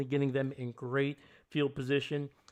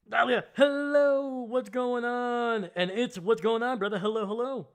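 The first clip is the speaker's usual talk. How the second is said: excited greeting; 9 semitones higher, 7 dB louder than usual. Real, not acted. Streamer welcoming a viewer, high excited hello. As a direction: sing out a big welcome.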